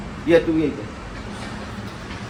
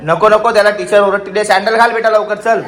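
louder, higher insisting; firm insistence; 5 semitones higher, 5 dB louder than usual. Real, not acted. Father arguing with his mother about socks, raised voice.

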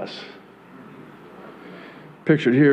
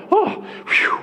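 mock relief. Sarcastic relief after quote.

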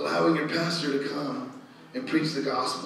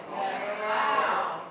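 ordinary delivery

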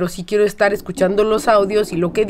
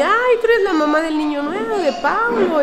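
much higher, gushing welcome from the teacher; 10 semitones higher than usual. Retold or imitated. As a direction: mimic the teacher's delighted welcome. Teachers greeting her warmly.

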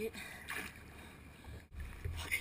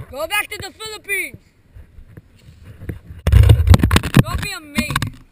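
shouting insults; 7 semitones higher, 13 dB louder than usual. Real, not acted.

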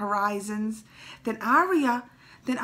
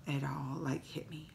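whispered, much softer, moved; choked whisper; 6 semitones lower, 8 dB quieter than usual. Real, not acted. Fan whispering how the scene hit her. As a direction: let it land in a whisper. Emotional moment told hushed.

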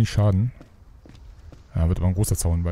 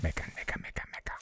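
fading into a whisper; breathy mock whisper; 18 dB quieter than usual. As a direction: whisper the grumbling away to nothing.

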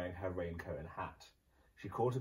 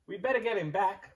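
Ma Gumboil; 10 semitones higher, 6 dB louder than usual. Acted. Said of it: "said Ma Gumboil".

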